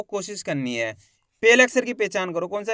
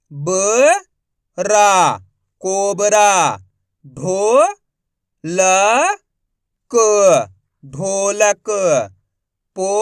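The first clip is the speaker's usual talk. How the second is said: loud syllable reading drill; 7 dB louder, much slower than usual. Shown as a demonstration.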